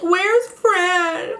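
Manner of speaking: mock crying. Big Key crying while looking for Fran, mock tearful. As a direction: mimic a sobbing search for a friend. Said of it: "And she's crying her eyes out".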